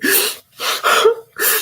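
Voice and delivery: loud, high crying gasp; exaggerated weepy intake of breath; mock sobbing gasp. Woman crying at the restaurant table, mock sobbing gasp. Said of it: "sitting up here crying".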